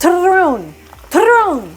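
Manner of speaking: Turron with a loud trilled r attempt; rolled r. Shown as a demonstration. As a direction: roll the r in Turron, loud and high. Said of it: "I'm terrible at rolling my R's".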